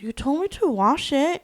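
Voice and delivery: high-pitched mock protest; defensive husband. Husband to fed-up wife, mock defensive. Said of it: "then the husband goes".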